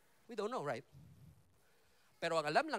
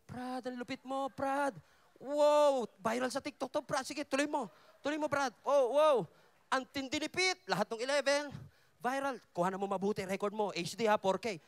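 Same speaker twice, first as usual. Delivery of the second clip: louder, higher excited cheering; hyped bro cheering; 6 semitones higher, 7 dB louder than usual. Acted. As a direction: cheer your buddy on like he's going viral. Disciples hyping Peter, filming.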